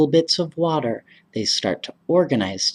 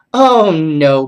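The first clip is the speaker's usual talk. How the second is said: protest; 3 semitones higher, 9 dB louder than usual. Acted. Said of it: "Ellen protesting Paul's teasing".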